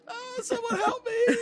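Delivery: put-on very high child's wail; hammed-up tearful plea; screaming and crying. Held little boy begs for help, high crying wail. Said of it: "as he is screaming and crying".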